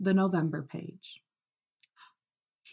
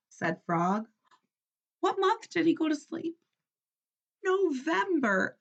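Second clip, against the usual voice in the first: ordinary delivery, nothing marked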